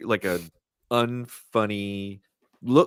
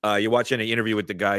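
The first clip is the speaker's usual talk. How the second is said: ordinary talk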